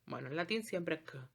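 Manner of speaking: usual speaking voice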